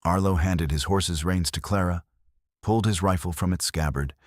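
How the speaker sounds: usual speaking voice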